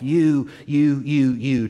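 single someone out with four firm hits on "you"; insistent YOU. Acted. Voice of God singling out a listener.

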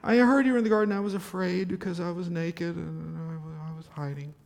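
a mild hint of a frightened man hiding; fearful Adam. Adam admitting to God he hid, fading fearfully. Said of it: "that's inflection, that's my interpretation".

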